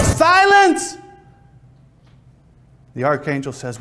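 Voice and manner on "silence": huge, high shouted command; God crying out. Acted. God halts all of creation, very high full shout. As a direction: bellow it over the din of creation. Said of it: "God cries out".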